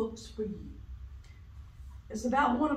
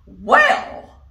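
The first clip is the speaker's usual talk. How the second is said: loud, high huff; huffing elephant; 12 semitones higher, 15 dB louder than usual. Acted. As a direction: huff it out impatiently. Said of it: "huffed the elephant".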